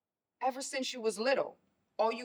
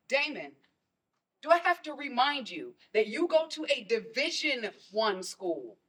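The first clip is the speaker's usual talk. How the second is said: normal talking voice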